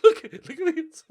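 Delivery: high, loud laughter through the words; giggling at a silly bet; laughing. Real, not acted. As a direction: laugh in disbelief at the odds. Laughing at betting odds.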